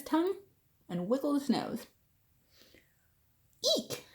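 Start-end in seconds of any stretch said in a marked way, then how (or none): 3.8-4.0 startled elephant squeal; frightened shriek. Acted. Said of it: "shouted the elephant".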